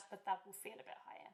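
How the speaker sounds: whispered aside. Real, not acted. Choir leader whispering an aside to the singers. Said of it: "whispered aside".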